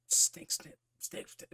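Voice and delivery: hissed high whisper of scissor snips. Retold or imitated. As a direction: breathe the snips like the monster's blades. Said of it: "did I say snake snip".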